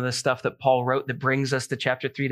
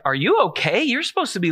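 retold concerned surprise; 6 semitones higher than usual. Retold wife answering worried call.

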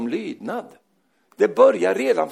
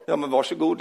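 ordinary talk, nothing marked

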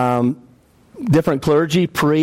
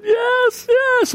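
much higher, a mother's sobbing yes, imitated; imitating tearful yes. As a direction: mimic her tearful yes in a high voice. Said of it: "she started just blubbering".

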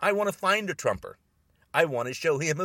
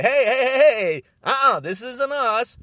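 loud, insistent call-out; calling a halt; 4 semitones higher, 6 dB louder than usual. Acted. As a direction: break in loudly to stop them. Acting out men standing up.

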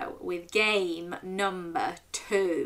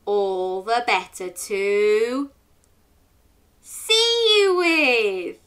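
big bad wolf; 2 semitones higher, 6 dB louder, much slower than usual. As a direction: draw it out then let the wolf cry out. Storyteller voicing the wolf.

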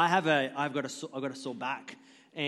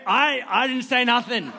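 louder, higher, laughing through it; laughing denial; 8 semitones higher, 9 dB louder than usual. Retold or imitated. Dad denying he put the kid up to it, laughing. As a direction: protest innocence while cracking up.

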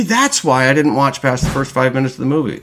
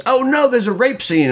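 mock gasp; 14 semitones higher than usual. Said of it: "I like to rant against".

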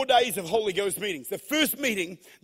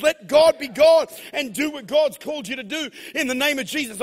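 loud, ringing preacher declaration; full-force preaching; 6 semitones higher, 7 dB louder than usual. Real, not acted. Preacher calling for a Holy Ghost takeover, full-force preaching. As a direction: proclaim it over the crowd.

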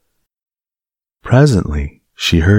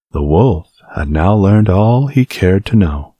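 ordinary delivery, nothing marked

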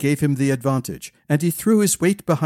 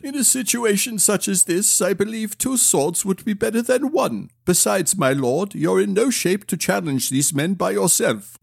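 higher-pitched, loyal retainer; earnest retainer; 5 semitones higher than usual. A narrator's reading. Retainer insists on joining prince, earnest retainer. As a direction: advise your lord in a higher voice.

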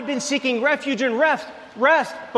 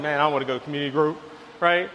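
lower, fast, grumbling complaint; whiny grumble; 6 semitones lower, faster than usual. Retold or imitated. Husband grumbling about going to community group, low and whiny. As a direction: mutter like you'd rather skip it. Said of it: "I'm like".

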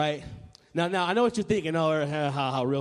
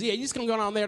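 hammed-up Southern cashier, pitched higher; mock Southern twang, higher than usual; Southern drawl; 4 semitones higher, much faster than usual. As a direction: imitate a Down South cashier, running into mumble. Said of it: "that's how they talk down south".

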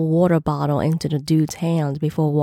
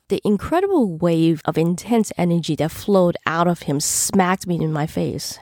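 ordinary delivery

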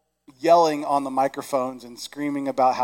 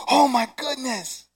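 amazed; 8 semitones higher, slower than usual. Acted. Shepherds amazed.

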